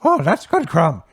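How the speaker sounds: put-on high, loud cheery old voice; hearty, pushing the bucket over; old tavern keeper. Herb handing Crom a huge bucket, high cheery old voice.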